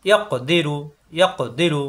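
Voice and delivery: ইয়াক দিরু with ক bounced before দিরু; qalqalah bounce. Shown as a demonstration. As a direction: release the ক of ইয়াক with a short echo. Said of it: "কলকলা হরফ হলে বাড়ি খাবে".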